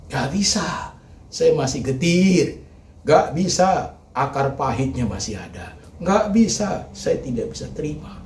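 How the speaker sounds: high, loud refusal, slow on getir; heart's protest. Pretend. The heart refusing to accept, loud protest. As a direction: cry out that it still hurts. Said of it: "Hatimu berkata".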